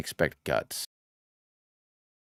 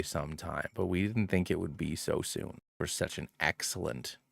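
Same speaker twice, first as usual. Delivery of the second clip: faltering tender eulogy. Pretend. Eulogy for lost starter Pokemon.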